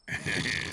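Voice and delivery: short soft laugh; quiet chuckle at the loser's jab; laughter. A narrator's reading. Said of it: "Lewis and Kyle laughed again".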